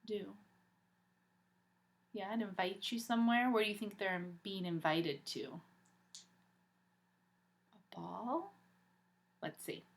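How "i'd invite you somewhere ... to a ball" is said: usual speaking voice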